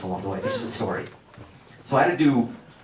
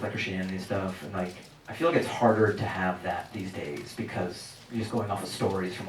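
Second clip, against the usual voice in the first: ordinary talk, nothing marked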